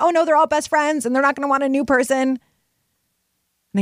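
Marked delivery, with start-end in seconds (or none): 0.0-2.4 higher worried inner voice; nervous newcomer fear; anxious worry. Retold or imitated. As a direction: fret in a higher voice. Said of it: "But then I thought".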